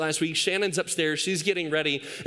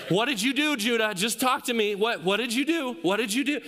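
higher, insistent questioning; imitated pleading parent; 5 semitones higher than usual. Parent to son after baby screams, acted pleading. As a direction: press the child for a confession, mock-desperate. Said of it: "so as a parent, you're like".

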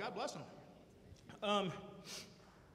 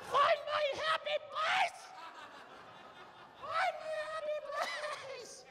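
shouted mantra; slower than usual. Pretend. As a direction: yell it high and slow. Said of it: "mantra now shouted".

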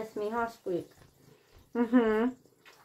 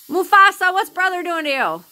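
high, loud call across the room; calling the cat; 6 semitones higher, 5 dB louder than usual. Real, not acted. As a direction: sing out the cat's name.

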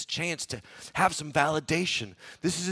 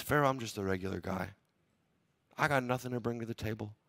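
humble reply; 5 semitones lower, 7 dB quieter than usual. Retold or imitated. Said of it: "Joseph replied to Pharaoh".

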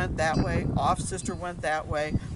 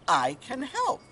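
higher and firmer, mildly cross; a little angry; 7 semitones higher, 4 dB louder, slower than usual. A narrator's reading. Unheeded voice offers help to father, a little angry. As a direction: insist with a touch of anger. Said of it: "louder and a little bit angry".

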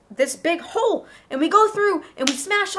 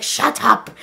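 loud, lower villain voice; angry orc; 4 semitones lower, 3 dB louder than usual. Acted. Orcs silencing the screaming girl, angry. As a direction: cut the child off harshly.

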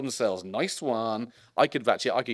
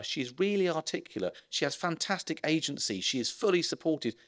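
normal talking voice

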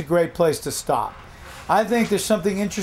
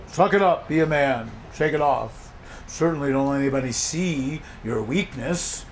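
tough-guy advice; 5 semitones lower, 4 dB quieter than usual. Acted. Voices what men are told.

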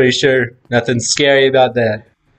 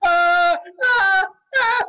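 scared yelp; much slower than usual. Real, not acted. Redstone trap jump scare.

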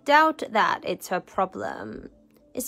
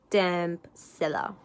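ordinary delivery

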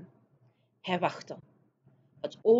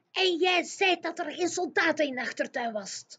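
giant's whisper; 10 semitones higher than usual. Acted. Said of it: "fluisterde de G V R".

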